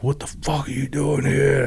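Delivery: menacing, knife-in-hand confrontation; retelling a veteran cornering a door-to-door salesman, intense; really intense. Retold or imitated. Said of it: "like, really, you know, really intense".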